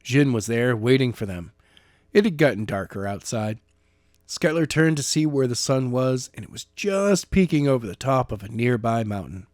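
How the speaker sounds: plain reading voice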